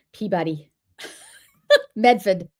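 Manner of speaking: "Peabody" and "Medford" in the local way, vowels squashed; Boston place names. Shown as a demonstration. Local lists hometown names for viewers, Boston pronunciation. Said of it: "we can't pronounce anything here".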